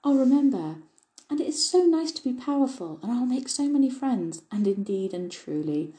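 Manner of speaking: high, eager promises tumbling out; bubbling with keen anticipation. A narrator's reading. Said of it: "said Dulcie eagerly".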